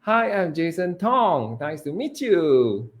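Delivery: slow singsong greeting, pitched high; overly friendly, stretched introduction; cheerful, high last word. Shown as a demonstration. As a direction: lift Tong and you up high. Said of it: "It sounds very cheerful".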